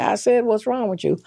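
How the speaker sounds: mother insisting her daughter answer; insistent mother. Retold or imitated. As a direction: imitate mom demanding an answer. Mom repeats demand, insisting.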